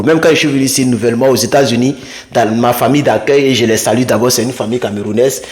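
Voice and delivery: normal talking voice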